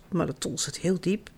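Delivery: fluisterend, heel zacht, bijna zonder stem; whispered. A narrator's reading. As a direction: zacht fluisteren alsof het een geheim is. Sad ending, voice drops.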